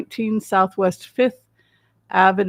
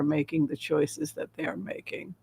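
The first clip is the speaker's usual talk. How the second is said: whisper; 4 semitones lower, 9 dB quieter than usual. Real, not acted. Musing question trailing to whisper.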